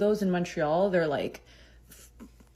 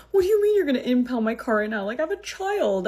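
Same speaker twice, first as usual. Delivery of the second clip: high, fast, louder panicked protest; freaking out; 6 semitones higher, 4 dB louder, faster than usual. Retold or imitated. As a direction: retell it as a frantic, high-pitched plea. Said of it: "I was, like, freaking out".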